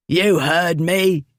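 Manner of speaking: drawn-out, slow menacing bully voice; rough bully. Acted. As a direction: lean on it like a surly drunk. Drunk bully confronts Ripley.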